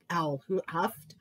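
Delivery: usual speaking voice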